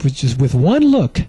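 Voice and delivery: ordinary talk, nothing marked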